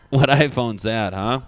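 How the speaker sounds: laughing. Acted. Joke about doctor's camera.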